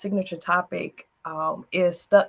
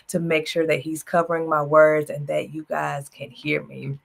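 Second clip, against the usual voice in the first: ordinary talk, nothing marked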